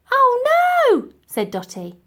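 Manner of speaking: put-on high, loud dismayed old lady; old lady in sudden dismay. Dotty dismayed.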